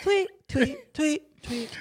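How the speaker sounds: high bird-like tweets; parakeet chatter. Retold or imitated. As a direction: chirp it like a little parakeet. High tweeting vocal imitation.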